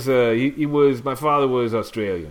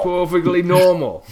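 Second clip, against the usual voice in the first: put-on louder Long Island accent, insisting; 6 semitones higher, 5 dB louder than usual. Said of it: "Long Island accent".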